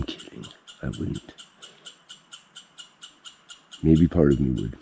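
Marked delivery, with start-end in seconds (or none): 0.0-1.3 whispered aside. Real, not acted. Joking aside after a threat.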